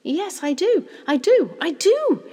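high, loud, bursting with excitement; choked-up excitement. A narrator's reading. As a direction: blurt it out, overcome with excitement. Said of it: "so choked up with excitement".

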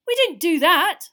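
high, loud denial; indignant protest. A narrator's reading. Harry denying Crouch's accusation, indignant protest. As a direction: protest your innocence hotly.

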